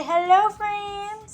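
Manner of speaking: high slow sing-song hello; sing-song prompt to baby. Shown as a demonstration. Mom prompting her baby to wave, high sing-song. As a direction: coax a baby to repeat it.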